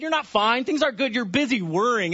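ordinary talk

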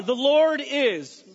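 "The Lord is" dragged out slowly, each word leaned on; slow emphatic phrase. Shown as a demonstration. Teaching the first three words, slow and emphatic. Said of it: "They are".

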